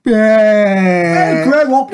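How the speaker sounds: ordinary talk, nothing marked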